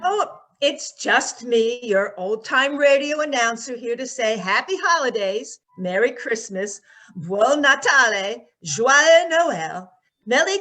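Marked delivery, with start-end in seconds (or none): none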